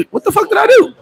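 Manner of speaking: can't believe there's a warrant; baffled outrage. Retold or imitated.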